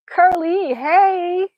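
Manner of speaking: loud, high, drawn-out hello; excited greeting. Real, not acted. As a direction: call out delightedly to a returning friend. Greeting a viewer who arrives.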